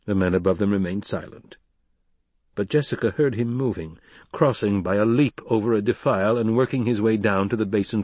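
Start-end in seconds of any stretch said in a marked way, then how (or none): none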